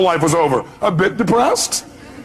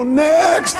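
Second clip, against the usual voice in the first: long, high-pitched shout; yelling; 11 semitones higher than usual. Shown as a demonstration. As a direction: yell it out high and drawn out. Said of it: "Just yell 'next.'".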